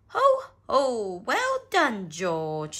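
loud, hearty ho-ho praise; Daddy Pig cheering. A narrator's reading. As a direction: boom out praise to a child. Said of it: "snort Daddy Pig".

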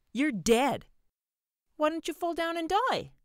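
put-on very high, loud child's shout; crying out. A narrator's reading. Boy firing toy pistol at a man who won't fall, shouting high. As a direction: shout it shrilly as a frustrated boy. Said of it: "he cried".

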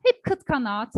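ordinary delivery

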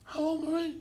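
high, teasing impression of Joe on the phone; imitation of Joe Regano. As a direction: pitch way up to mimic Joe. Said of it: "I said".

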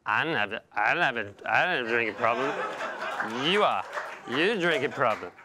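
put-on slurred drunk, words running together; sloppy, slurring drunkard insisting they're fine; drunk in denial. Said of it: "the other twenty-five percent say".

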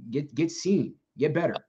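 normal talking voice

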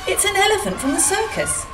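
very high, surprised announcement; amazed discovery. Acted. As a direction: marvel at it, voice leaping up. Foreman astonished at the elephant.

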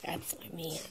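ordinary delivery

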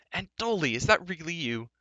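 high, excited greeting; pitched well up, thrilled to see them; delighted greeting. A narrator's reading. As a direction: greet an old friend in happy disbelief. Hailing a long-missed friend.

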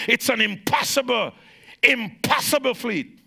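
high, loud preacher's shout; full-force preaching. Acted. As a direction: preach it out with fire.

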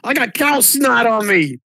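put-on very high, excited child voice; a little kid thrilled to be covered in cow snot; delighted little kid. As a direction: squeal to mommy with glee. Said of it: "It's like".

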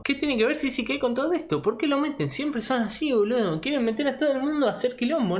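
a squeaky, offended fan yelling at the haters; whiny fan voice. Pretend. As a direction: play an indignant fanboy crying foul. Whiny mock fan defending Sisi.

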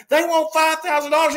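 high, loud urgent announcement; loud report. Retold or imitated. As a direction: blurt out the ransom demand loudly. Teammates tell Marino the demand.

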